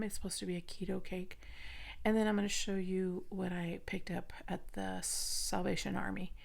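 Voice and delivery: hushed, mostly whispered chatter; whispering. Real, not acted. Keeps whispering for the sleeping baby.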